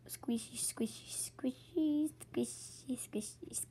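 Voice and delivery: whispered squishy chant; breathless whisper over cute toys; overwhelmed by cuteness. Real, not acted.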